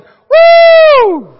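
very high, long whoop; yelled woo. Retold or imitated. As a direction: imitate a cheering fan's loud, sustained whoop. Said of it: "you're yelling, you're like".

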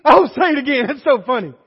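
loud, gushing mock delight; delighted mock amazement. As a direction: burst out as if tickled by someone's speech. Amused Northerners beg to hear drawl.

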